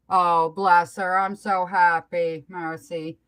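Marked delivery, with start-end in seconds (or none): none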